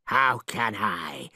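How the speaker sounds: a hint of a gloating dragon. Dragon answering.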